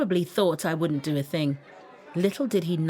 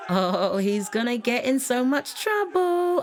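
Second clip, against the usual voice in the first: ominous taunting; 7 semitones higher than usual. A narrator's reading. Onlooker warning of trouble after a fight, ominous taunting. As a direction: stretch it out high, relishing the trouble.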